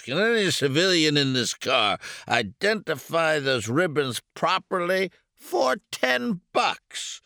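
put-on loud, high drunken showman; hammy drunk officer speech; drunk major. A narrator's reading. Drunken major addresses the car.

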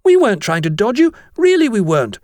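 high-pitched earnest schoolboy denial; innocent protest. A narrator's reading. Jennings assures the master, high innocent voice. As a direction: plead that you did nothing wrong. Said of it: "Jennings was round-eyed with innocence".

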